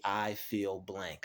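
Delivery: slow, deliberate, naming the feeling; measured, unhurried statement; slow calm self-talk. Shown as a demonstration. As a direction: say it calmly to yourself. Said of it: "you're going to want to say".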